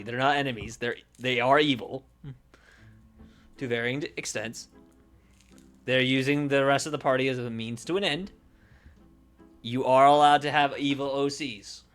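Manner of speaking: ordinary talk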